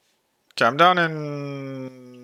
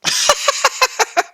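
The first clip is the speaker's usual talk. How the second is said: high, breathy run of laughs; helpless laughter. Real, not acted. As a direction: laugh uncontrollably at the song. Laughing at the song.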